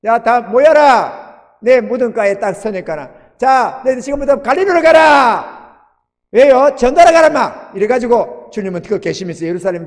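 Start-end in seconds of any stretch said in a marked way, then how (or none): 0.0-7.7 loud, higher mock-command voice; bossy commanding Lord. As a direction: bark orders like a gruff boss sending the disciples off. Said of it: "이렇게 주님이 이러는 건 아니잖아요".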